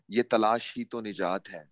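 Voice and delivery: ordinary delivery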